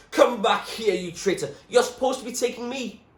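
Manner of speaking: loud, high furious yell; yelling his head off. A narrator's reading. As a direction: rage at the traitor. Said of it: "yelling his head off".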